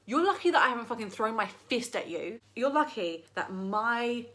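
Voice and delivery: loud, emphatic acted threat; hammed-up outrage, punching the key words; mock furious retort. Acted furious retort to rude person.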